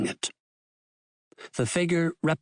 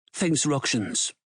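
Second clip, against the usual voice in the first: ordinary delivery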